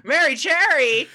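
high, loud, stretched-out squeal; excited catchphrase shout. Pretend. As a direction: shriek the name back with glee. Excitedly echoing host's catchphrase.

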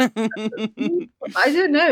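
laughing through the words, drawn out. Real, not acted. Laughing off the interviewer's muse question. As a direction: giggle it out slowly.

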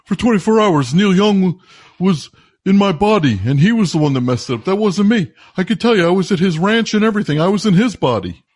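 high, loud mock pleading; frantic self-defense. Caller insisting to disbelievers that [name] did it, frantic protest.

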